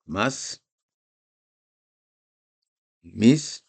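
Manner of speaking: "Mas", "mis" each as one syllable, closed on the consonant; model readings. Shown as a demonstration. Teacher listing soukoun examples to students, careful. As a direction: clip "Mas" and "mis" short. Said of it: "accent de anticipation".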